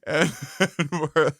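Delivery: usual speaking voice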